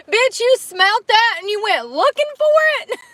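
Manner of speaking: high, loud, laughing disbelief; can't believe what she did; shrieking amazement; incredulous. Real, not acted. Disbelief at the passerby.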